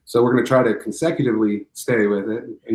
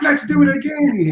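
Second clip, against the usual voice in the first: high, loud, drawn-out imitation of kid glee; laughing, over-excited mock child voice; thrilled little kid; 8 dB louder, slower than usual. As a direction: squeal it like a kid on a roller coaster. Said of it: "like a little kid on a roller coaster".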